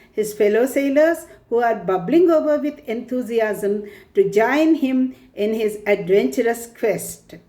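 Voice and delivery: normal talking voice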